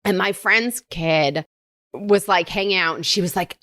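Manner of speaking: ordinary talk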